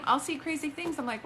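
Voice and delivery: ordinary talk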